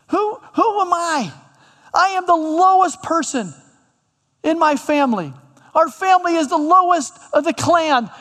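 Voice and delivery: hammed-up insecure retort; protesting Gideon. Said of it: "And Gideon retorts".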